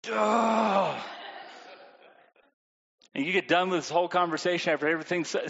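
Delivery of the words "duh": mock whiny duh with a crying edge; sarcastic kid. Child sarcastically answering the parent. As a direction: toss it off like a snotty kid.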